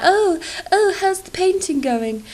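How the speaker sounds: mocking friends. Friends asking about her paintings, in a mock high chirpy voice. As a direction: mimic a cheery friend asking, overdone. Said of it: "messages from my friends sometimes being like".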